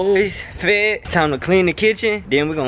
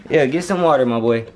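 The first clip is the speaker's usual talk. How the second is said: ordinary delivery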